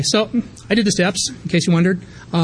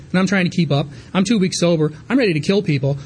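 ordinary delivery

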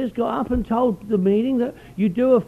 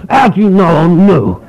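loud, surprised newcomer voice; newcomer's reply; 11 dB louder than usual. Retold or imitated. Newcomer asked if he's new, loud surprised reply. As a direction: blurt it out like a startled newcomer. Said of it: "And they go".

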